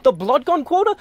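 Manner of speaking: can't believe what he just heard; scoffing at the blood gun quota; incredulous disbelief. Real, not acted. Outraged at the studio's quota.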